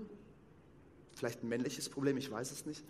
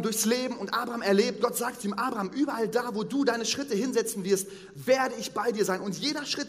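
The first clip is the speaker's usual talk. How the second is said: raised, higher, louder fervent sermon voice; preaching at full force; 6 semitones higher, 7 dB louder than usual. Acted. Preacher to the congregation about Abraham, fervent preaching. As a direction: proclaim it like a revival preacher.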